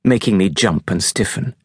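plain reading voice

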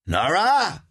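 high, loud call into the house. Acted. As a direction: call her name searching. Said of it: "hörte sie eine Stimme rufen".

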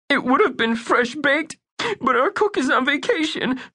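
put-on high, anxious young boy; earnest little boy. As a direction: play Bunky as a worried young kid. Bunky voice.

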